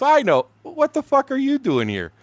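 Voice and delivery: high, loud mock surprise; brother's loud startled hello; excited greeting.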